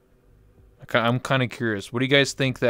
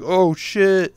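panicked yelp; 7 semitones higher, 4 dB louder than usual. Retold or imitated. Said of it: "they're like".

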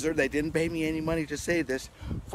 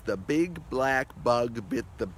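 slow, careful recitation; deliberately slow read; slow tongue twister; 4 semitones lower, slower than usual. Shown as a demonstration. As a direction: say every b slowly. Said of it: "One of the most famous ones is".

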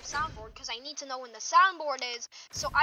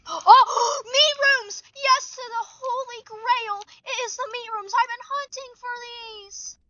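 higher and louder, gasping with joy; thrilled find; 9 semitones higher, 5 dB louder than usual. Real, not acted. Finding the rare rooms at last, thrilled and gasping. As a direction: burst out like finding treasure.

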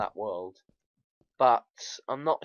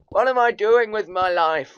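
raised, exasperated self-questioning; mock despair; 7 semitones higher, 12 dB louder than usual. Real, not acted. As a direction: wail it in comic despair. Mock despair at own play.

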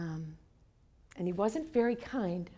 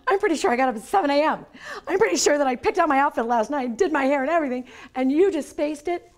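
indignant disbelief; 6 semitones higher, 11 dB louder than usual. Retold or imitated. Confronting date who forgot.

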